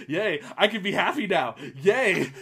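mock-happy cheer. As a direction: act out giddy relief. Acts out own relieved reaction.

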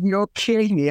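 high, delighted gasp of disbelief; like you just can't believe your luck; retold happy astonishment; amazed disbelief. Amazed at identifying nine birds.

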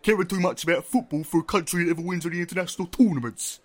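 rattling off British clichés in a put-on British accent, speeding up; a hammed-up British accent; mock British accent. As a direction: lampoon the British in a faked British accent. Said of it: "And they're British".

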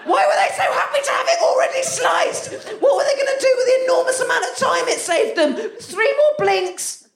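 high-pitched comic rant; mock outrage. Comedian ranting about people wanting pre-sliced bread, high mock-outraged shouting. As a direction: shriek it in exasperated disbelief.

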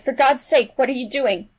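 loud, high outburst; alarmed cry. A narrator's reading. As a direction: cry out in horrified disbelief. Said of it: "he cried".

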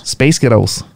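"Space Ghettos" with American vowels; American accent. Shown as a demonstration. Showing the co-host the accent trick, American accent. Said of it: "in an American accent".

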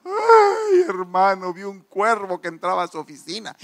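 high wailed opening, mock sobbing; mock crying. Preacher imitating a crying church sister reporting the crow, tearful wail. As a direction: imitate a woman wailing in tears. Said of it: "entra una hermana llorando".

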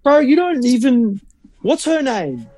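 high-pitched mock disbelief; incredulous retort. Mock retort to agency planner.

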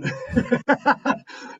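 high breathy burst of laughter. Real, not acted. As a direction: let out a silent laugh. Laughing about his wife.

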